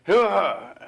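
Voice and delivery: high, loud unintelligible mumble; garbled cashier words. Retold or imitated. Cashier answering with unintelligible noise, high and loud. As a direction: make the words a blur nobody can catch. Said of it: "I cannot understand a word this guy's saying".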